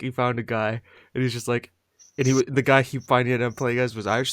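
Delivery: ordinary delivery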